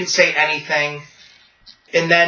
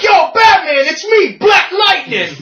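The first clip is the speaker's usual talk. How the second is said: high, loud hollered greeting; 11 semitones higher, 4 dB louder than usual. Retold or imitated. Hero hailing Batman across a funeral, yelling. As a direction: yell it across the room. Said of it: "he yells to, to Batman".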